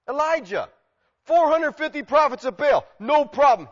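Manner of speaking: ordinary talk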